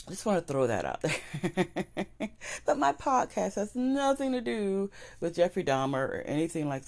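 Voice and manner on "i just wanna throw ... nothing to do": laughing. Real, not acted. Laughs after aside.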